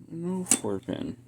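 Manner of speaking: slow, drawn-out letdown; disappointed. Real, not acted. Disappointed at missing connector.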